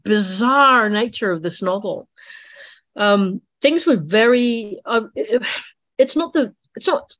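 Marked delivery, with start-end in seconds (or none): 0.0-2.1 excited. Retold or imitated. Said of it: "And I was just".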